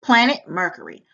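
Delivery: plain reading voice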